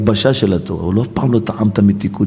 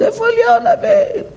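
rising into a high, wailing cry; mock weeping. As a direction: wail for the lost prophet, half in jest. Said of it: "לבכות".

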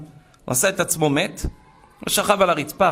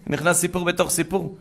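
ordinary talk, nothing marked

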